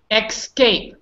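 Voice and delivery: Escape said EK-skape, with an x; deliberate "ex-cape" error; wrong pronunciation. Shown as a demonstration. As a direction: put a k sound before the s in Escape. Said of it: "Here's the wrong, wrong one".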